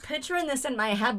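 ordinary delivery

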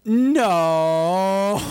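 long drawn-out "no" dissolving into laughter; stretched, laughing no; imitating dad's laughing. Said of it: "starts laughing. He's like".

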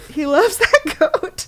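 slow, high, loud, through laughter; gleeful laughing emphasis. Real, not acted. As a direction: crack up over the words. Delighted by the character's goat fondness.

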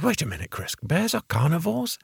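soft, puzzled question climbing high on the end; incredulous interruption. Retold or imitated. Boss interrupting the visiting bear expert, mildly incredulous. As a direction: imitate a baffled boss double-checking.

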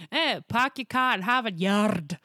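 loud, slow accent slipping toward Scottish; botched accent demo, Scottish creeping in; failed accent attempt. Shown as a demonstration. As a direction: declaim slowly in a muddled Scottish accent. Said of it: "all I can do is Scottish and French".

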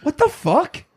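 high disbelieving outburst; incredulous, fast rant. Real, not acted. Outraged reaction to the movie.

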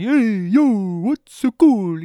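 put-on loud, brash Italian tough guy accent. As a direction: swagger it out in a thick Italian accent. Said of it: "the thick Italian accent that he was putting on".

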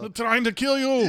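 high, strained Austrian action-star cry; Arnold impression. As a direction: imitate an Austrian action hero in peril. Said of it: "you can never get enough Arnold".

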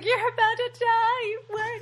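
very high, giddy squeal; giddy hysterics. Retold or imitated. Host mimics movie husband cracking up, giddy high squeal. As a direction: imitate a man squealing with hysterical glee. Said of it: "laughing hysterically".

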